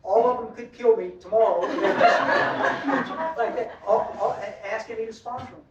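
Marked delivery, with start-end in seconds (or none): 1.7-3.3 real laughter. Real, not acted. Laughs after joke about guys.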